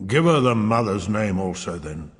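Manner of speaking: lightly voiced sulky uncle; a hint of a grudging king; grumpy mumble. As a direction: concede it sourly. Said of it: "Fat George mumbled".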